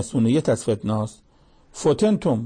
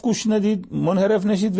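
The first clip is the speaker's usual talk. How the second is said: higher, urgent pleading of a prophet; a prophet calling his people back, raised and urgent; voicing Aaron's plea; 8 semitones higher than usual. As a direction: beg them not to stray. Aaron voiced warning people.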